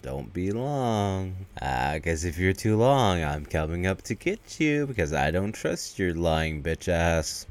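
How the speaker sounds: low, drawn-out creepy warning; mock menacing. [name] warns Karen not to stall, low slow mock menace. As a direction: imitate a slow, low threat.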